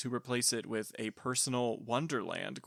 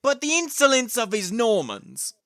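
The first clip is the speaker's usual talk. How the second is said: put-on Cockney accent; 6 semitones higher, 9 dB louder than usual. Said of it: "really bad Cockney accent".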